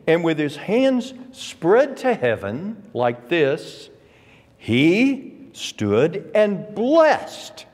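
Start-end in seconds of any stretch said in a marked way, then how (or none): 4.6-7.7 slow and deliberate, every word weighted; emphatic. Shown as a demonstration. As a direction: lay the full weight on "he stood and blessed". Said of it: "let me give it to you emphatically".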